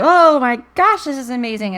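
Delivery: high, loud burst of amazement; thrilled outburst, easing on the last words; acted elation. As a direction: gush as if money just landed. Voices elated reaction to sudden money.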